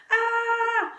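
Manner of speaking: very high, loud shriek; mother's scream. A narrator's reading. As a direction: shriek in horror at her sons. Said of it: "she screamed too".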